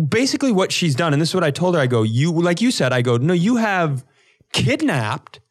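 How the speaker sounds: ordinary delivery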